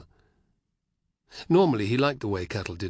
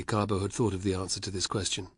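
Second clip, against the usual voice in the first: plain reading voice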